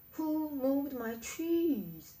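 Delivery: loud, high, slow drawn-out holler. A narrator's reading. Ham shouting for someone to put it back, hollering. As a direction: holler it out, stretching the words. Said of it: "he hollered".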